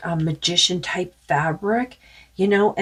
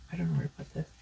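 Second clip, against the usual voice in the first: whispered aside; 12 semitones lower, 12 dB quieter, much faster than usual. Real, not acted. Muttering aside while handling cards.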